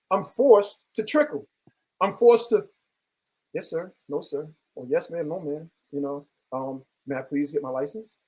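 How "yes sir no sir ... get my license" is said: soft, low, careful politeness to a cop; meek yes-sir voice to the officer; fake polite submission. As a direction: play it small and deferential. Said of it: "I have to be fake".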